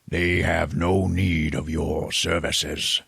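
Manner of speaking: very slow, drawn-out villain voice; menacing Tall Man. Retold or imitated. Tall Man confronting the priest, slow and ominous. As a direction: stretch it out like a looming movie villain. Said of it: "the tall man just goes".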